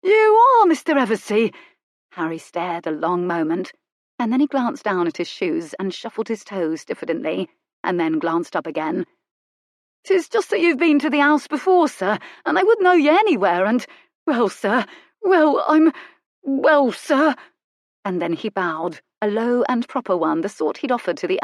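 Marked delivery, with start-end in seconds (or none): 0.0-1.5 high, loud, wide-eyed footman voice; awestruck footman. Acted. Footman meeting famous Colin, high and awestruck. 10.0-17.3 high, loud, stammering servant voice; flustered footman. Acted. Footman shyly admiring Colin, high and flustered.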